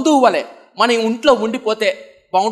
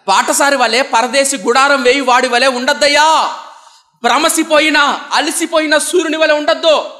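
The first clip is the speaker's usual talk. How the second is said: louder, pleading prayer voice; fervent prayer; 5 semitones higher, 4 dB louder than usual. Acted. Preacher voicing the devotee's plea to the Lord, louder fervent prayer. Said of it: "భక్తుడు ఒక మాట రాస్తున్నాడు".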